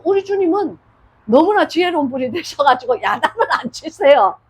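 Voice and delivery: ordinary talk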